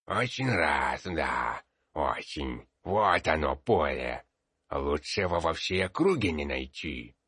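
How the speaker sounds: put-on sly old codger, gummy and slow; a crafty old peasant selling land; old man voice. A narrator's reading. Old man shows his field.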